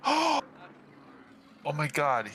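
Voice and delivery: high gasping shock at bad news; character stunned to hear of a death.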